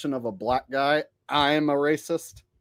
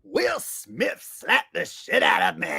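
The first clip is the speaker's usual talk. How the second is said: slow, drawn-out comic impression; Chris Rock; slower than usual. Comic telling a crowd he got slapped, slow mock Chris Rock voice. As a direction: stretch it out slowly like a stand-up punchline. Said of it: "Haven't done Chris Rock in a while".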